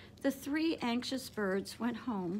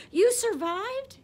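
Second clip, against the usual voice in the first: much higher, louder joyful cry; cried out; 11 semitones higher, 9 dB louder, slower than usual. A narrator's reading. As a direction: burst out in amazed delight. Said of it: "cried Stella Luna".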